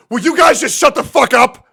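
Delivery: loud mock angry outburst; imitating an annoyed shush. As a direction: snap at noisy friends. Retelling Emily snapping.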